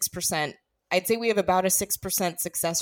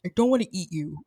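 quoted line; 2 semitones lower, faster than usual. Retold or imitated.

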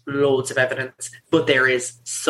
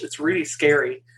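usual speaking voice

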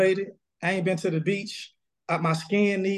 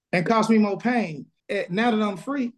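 normal talking voice

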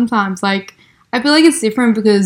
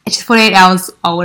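ordinary delivery

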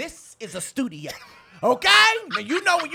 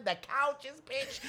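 ordinary talk

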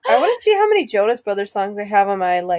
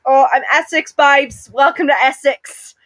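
mock Essex accent; 2 semitones higher than usual. Host slips into a light mock Essex accent for her cohost. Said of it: "And then sometimes Essex".

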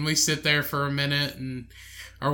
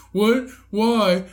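higher, louder retold questions; startled drunk friend; 5 semitones higher, 5 dB louder than usual. Drunk friend to buddy on being told to leave, confused.

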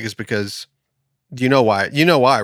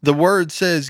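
ordinary talk

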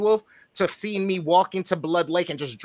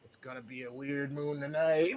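much softer, low ominous murmur; ominous farmer; 5 semitones lower, 13 dB quieter than usual. Retold or imitated. Imagined farmer warning about the night, soft ominous murmur. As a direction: mutter it like a creepy local. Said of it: "and he's like".

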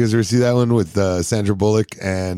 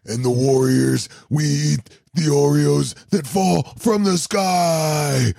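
slow, drawn-out wrestling promo; Ultimate Warrior promo; 6 semitones higher, slower than usual. Pretend. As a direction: cut it like a wrestler hyping the crowd. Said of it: "You gotta cut a promo on that".

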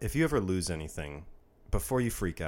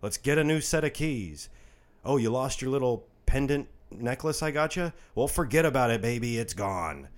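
raised, exasperated mock yell; loud, higher-pitched retold scolding; 6 semitones higher, 4 dB louder than usual. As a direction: act out yelling at a partner to drop it. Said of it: "me yelling at you like".

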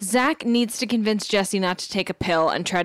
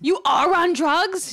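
higher, slow, aghast question; mock horrified; 9 semitones higher, slower than usual. Imitating Zach's horror at Jesse's drug use, slow and aghast. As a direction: draw it out in shock. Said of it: "Zach is horrified".

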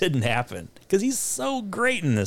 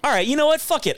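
normal talking voice

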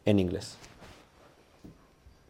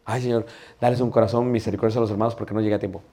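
quick, soft, lower-pitched plea; muttered prayer; 4 semitones lower, 6 dB quieter, faster than usual. Acted. Preacher praying for his brothers while running late, quick and low. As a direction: rattle off a quiet prayer. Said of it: "Estoy orando".

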